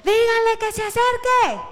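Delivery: very high, loud holler; yelling. Acted. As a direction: shout it across a crowd. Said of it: "haya pegado un grito y un alarido".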